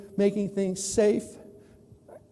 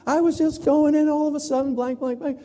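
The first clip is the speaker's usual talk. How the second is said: excited gossip; 7 semitones higher than usual. Retold or imitated. Telling friends about the wreck, high and excited. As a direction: spill the news to a friend, pitched up high. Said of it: "you call up your friends and you go".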